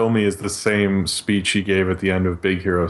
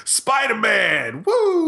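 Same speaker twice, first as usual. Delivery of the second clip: high, drawn-out cheering yell; ad guy's yell; 12 semitones higher, much slower than usual. Retold or imitated. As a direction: whoop like an overexcited ad actor. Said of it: "Best Buy ad where he's like".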